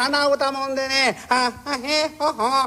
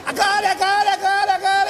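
tipsy master; 7 semitones higher than usual. Retold or imitated. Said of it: "言いながらですよ".

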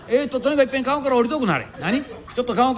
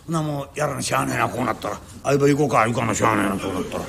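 much lower, sinking grudging mutter; resigned grumble; 12 semitones lower than usual. Acted. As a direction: give up with a low groan. Bearers resigned to carry on.

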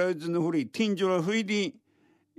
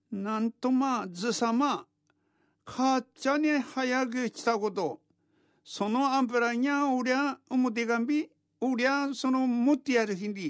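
sly fox voice; 8 semitones higher than usual. A narrator's reading. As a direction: coax the old man sweetly. Fox flatters old man.

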